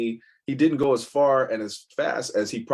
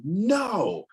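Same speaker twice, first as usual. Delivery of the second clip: a boy snapping back at his father, half-replayed; mock yell of a kid losing it; retold defiant shout; 9 semitones higher than usual. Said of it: "I lost my mind for a second".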